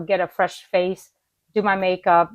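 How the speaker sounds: normal talking voice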